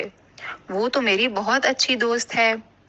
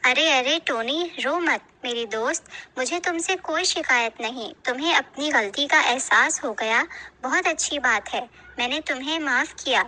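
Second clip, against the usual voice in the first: gentle friend's voice; 4 semitones higher than usual. Acted. Chinki voiced comforting Tony. (چنکی کی نرم، اونچی آواز میں تسلی)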